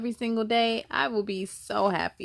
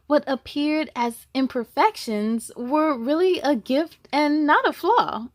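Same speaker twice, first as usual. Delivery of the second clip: louder, lively storybook narration; reading to children; 6 dB louder than usual. A narrator's reading. Reading the zebra story to kids, lively and louder. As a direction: tell it brightly to the little ones. Reads the picture book aloud.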